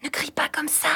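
soft whispered telling-off; low, secretive whisper; hushed shushing. Acted. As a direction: shush your companion under your breath. Hushing companion who shouted at night.

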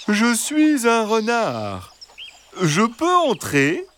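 high, slow, sweet fox voice; gentle reassuring fox. A narrator's reading. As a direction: coax them like a kindly fox. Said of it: "Une voix douce et rassurante".

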